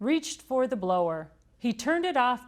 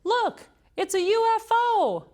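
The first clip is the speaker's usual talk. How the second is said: very high, slow, loud cry; excited shout; 5 dB louder than usual. A narrator's reading. Emily spotting the bonnet, shouting excitedly. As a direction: shout it across the gym. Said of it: "Emily shouted".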